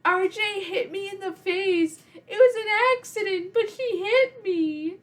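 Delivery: put-on high, whiny little boy voice, the end slow; imitating a sobbing boy telling on his sister; crying kid. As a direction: whimper like a hurt little brother. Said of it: "and he's crying".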